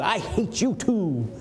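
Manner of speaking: hammed-up spite; mock hateful. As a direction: drag it out childishly. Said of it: "Go to your mother".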